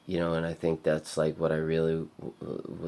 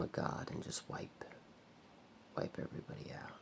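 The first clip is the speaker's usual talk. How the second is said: whisper; 13 dB quieter than usual. Real, not acted. Whispering about wiping people out.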